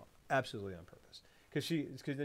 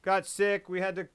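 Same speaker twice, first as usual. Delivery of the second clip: high, loud son's voice; voiced son; 9 semitones higher, 9 dB louder than usual. Son telling of his sick friend, high and loud. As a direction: play the son explaining himself, pitched right up. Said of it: "the son comes in, and he's like".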